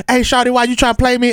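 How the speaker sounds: high playful Atlanta twang; mock Atlanta accent. Retelling her impression, a mock Atlanta accent. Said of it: "she tried to do a little Atlanta".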